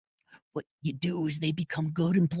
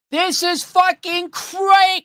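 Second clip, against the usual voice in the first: stretched outrage; 11 semitones higher, 5 dB louder, much slower than usual. Real, not acted. Outraged at the situation.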